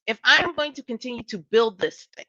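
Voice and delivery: ordinary talk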